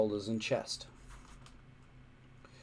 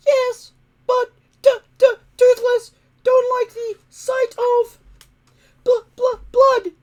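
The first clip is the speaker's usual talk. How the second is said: high, stammering whine, near tears; squeaky stuttering dragon, close to sobbing; whining dragon; 13 dB louder than usual. A narrator's reading. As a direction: whine it like a frightened little dragon. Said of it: "whined Toothless".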